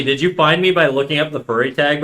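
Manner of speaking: ordinary delivery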